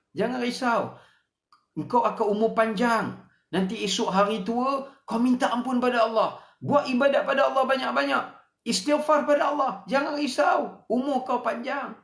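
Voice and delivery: high, rising mock devil's coaxing; Satan's tempting voice. Satan urging a sinner to delay repentance, in a high coaxing voice. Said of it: "Inilah kerja syaitan".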